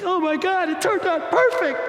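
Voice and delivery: high, excited burst of relief; relieved brother. Retold or imitated. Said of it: "it's like".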